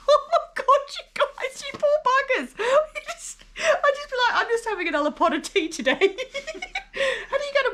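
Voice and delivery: high-pitched giggling through the words; laughing. Real, not acted. Host laughing at guests' moose stories, giggling. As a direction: laugh helplessly while talking. Laughing at scary foreign animals.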